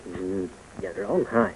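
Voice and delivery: soft, hushed soothing; quiet reassuring murmur; tender comfort. Acted. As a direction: gently hush the crying child. Grandfather comforts crying grandson.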